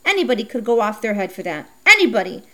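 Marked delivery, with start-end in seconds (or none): 1.9-2.4 indignant outburst. Acted. Girl left out by the bike boys cries out Anybody! loudly.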